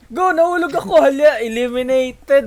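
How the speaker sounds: loud, high panicked yell; screaming while falling. Real, not acted. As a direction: scream as you drop out. Falls out of the game.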